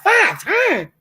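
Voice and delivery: high, sharp "Five times?" in disbelief; can't believe the number; shocked disbelief. Retold or imitated. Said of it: "I'm like".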